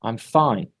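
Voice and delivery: low, downbeat reply; not fine. Shown as a demonstration. Teacher answers his students again, low and not fine. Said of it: "he doesn't sound fine".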